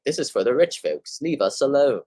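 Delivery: lightly put-on snobby rich-folks accent; mildly mocking posh neighbor voice; mock snooty accent. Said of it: "I don't know why I say it in that accent".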